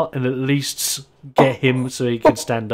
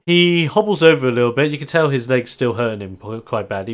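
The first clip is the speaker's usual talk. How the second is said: usual speaking voice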